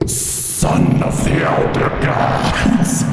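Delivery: low, slow, whispered demon voice; demonic whisper. Retold or imitated. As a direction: breathe it like a spirit. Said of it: "I leaned into my mic".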